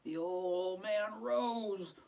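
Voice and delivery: put-on slow, drawn-out old man rose; slow weary narration; slow heavy rising. A narrator's reading. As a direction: drag it like stiff old bones.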